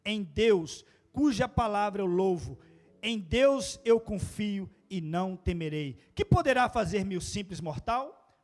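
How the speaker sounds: loud, slow scripture reading; preacher reading the psalm aloud to the church; psalm proclaimed. Real, not acted. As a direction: proclaim the psalm loudly and slowly.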